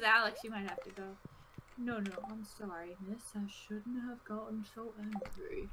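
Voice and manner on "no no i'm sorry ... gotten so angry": put-on softer, apologetic British old man; British accent. Old man says sorry to young woman, soft British accent. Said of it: "He went from Southern to British".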